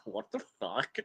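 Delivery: quiet, puzzled mock voice of a nerdy brother; brother's reaction. Streamer mimics her nerdy brother reacting to her stream, quiet mock puzzlement. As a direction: play the bewildered nerd brother, softly. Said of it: "he would be like".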